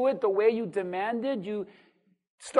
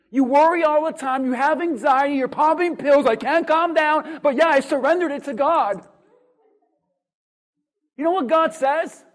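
louder, rising preacher's call; a pastor building to a peak; full-force preaching; 3 semitones higher, 4 dB louder than usual. Acted. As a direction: drive it home to the congregation.

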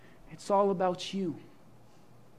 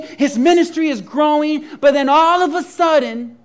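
louder, higher fiery sermon voice all the way; preaching at full force; 8 semitones higher, 16 dB louder than usual. Acted. Pastor retelling John the Baptist to the congregation, loud fiery preaching. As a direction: drive the story home like a revival preacher, loud and high.

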